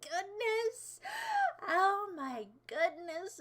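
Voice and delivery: high, drawn-out hammed-up astonishment; mock amazement. Streamer realizing they are a babushka, playful amazement. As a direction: open loud, then marvel slowly.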